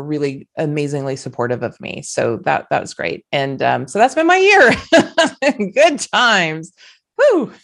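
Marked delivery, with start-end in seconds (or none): 3.9-7.5 high, loud and giggly, ending on a whoop; breezy high laughter closing the story; laughing excitedly. Real, not acted. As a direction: laugh it off with a gleeful woo. Bubbly wrap-up of her year.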